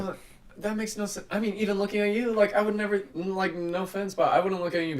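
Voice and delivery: high, animated disbelief; excited disbelief. Real, not acted. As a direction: push it up with disbelief. Incredulous excited rant.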